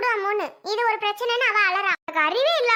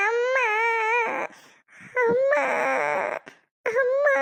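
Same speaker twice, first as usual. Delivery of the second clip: put-on crying child; 2 semitones higher, much slower than usual. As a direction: bawl it out like a small child. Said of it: "விடாம அழுகுது பாரு". (அம்மாவைக் கூப்பிட்டு அழும் குழந்தை)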